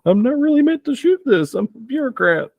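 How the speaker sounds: very high, loud comic falsetto; high-pitched mock helplessness; squeaky bureaucrat. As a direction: protest like a hapless bureaucrat out of their depth. Comic in-character protest while shooting.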